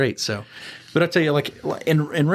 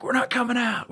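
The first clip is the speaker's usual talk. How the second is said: high-pitched stubborn refusal; mock defiance; 10 semitones higher than usual. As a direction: imitate a holdout digging in, pitched up. Inmate refusing, retold.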